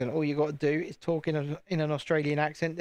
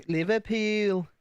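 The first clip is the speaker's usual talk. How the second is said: slow, higher Liverpool accent, last vowel stretched; drawn-out Scouse accent on the place name; 5 semitones higher, slower than usual. Shown as a demonstration. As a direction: put on a Liverpool accent, lifted and lengthened. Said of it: "I could do Northern kinda".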